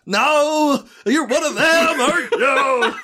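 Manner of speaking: high-pitched, badly faked American accent; mock a terrible attempt at an American accent; Alan Rickman's bad American accent. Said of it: "He was doing his American accent".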